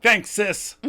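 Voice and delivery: cheery thanks. Retold or imitated. Ross thanking his sister.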